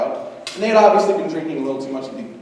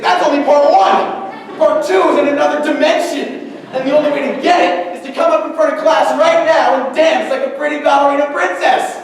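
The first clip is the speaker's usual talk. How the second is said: pompous teacher; 8 semitones higher, 5 dB louder than usual. Retold or imitated. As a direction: announce it like a cruel ringmaster. Teacher giving absurd demand.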